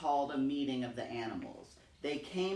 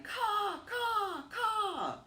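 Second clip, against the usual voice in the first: much higher, sharp caw calls; a crow raising the alarm across the forest; much slower than usual. Acted. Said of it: "He flew into the forest crying".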